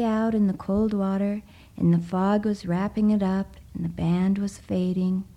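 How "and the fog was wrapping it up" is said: plain reading voice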